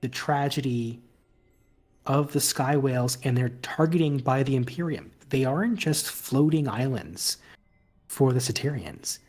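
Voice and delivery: measured, storytelling scene-setting; game master narration. Acted. Game master describing the sky whales to a player, measured narration. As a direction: narrate it gently, with long pauses between phrases.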